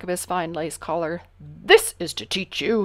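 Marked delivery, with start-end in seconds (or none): 1.4-2.9 stern. A narrator's reading. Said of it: "His Majesty said sternly".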